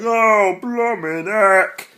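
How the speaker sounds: high, loud, slow put-on exclamation; mock dying exclamation. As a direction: cry it out as a hammed-up beheaded man. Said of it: "he'd be like".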